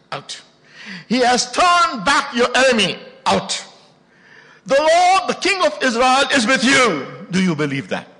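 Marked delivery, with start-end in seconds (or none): 1.1-7.3 loud, high, slow proclamation of scripture; preaching at full force. Acted. Preacher proclaims Zephaniah to the church at full force. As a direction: thunder the verses to the congregation.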